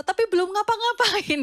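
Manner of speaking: ordinary delivery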